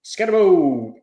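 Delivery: Scatibo drawn out as "SKET-uh-bo"; demonstrated pronunciation. Shown as a demonstration. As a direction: show how Scatibo goes, first syllable stressed, last vowel held. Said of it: "how do I pronounce".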